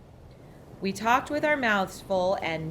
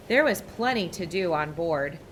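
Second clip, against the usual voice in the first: ordinary delivery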